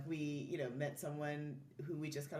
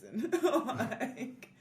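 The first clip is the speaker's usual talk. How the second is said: laughing; 8 semitones higher, 9 dB louder than usual. Real, not acted. Story about near-drowned husband.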